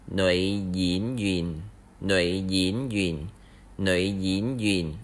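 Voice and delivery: "nữ diễn viên" repeated slowly, falling on "diễn"; Vietnamese nữ diễn viên. Shown as a demonstration. Teacher drilling the word for actress. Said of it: "you just say".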